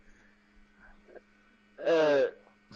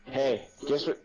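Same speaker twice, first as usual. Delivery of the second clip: usual speaking voice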